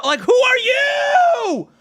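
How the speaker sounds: slow, high, drawn-out yell; exasperated shout. Real, not acted. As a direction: demand who they are, outraged. Ranting at a TikToker.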